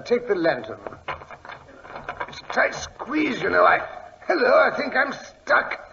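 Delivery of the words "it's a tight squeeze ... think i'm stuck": straining through a gap. Acted. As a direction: squeeze through a tight hole as you talk. Squeezing through hole in wall.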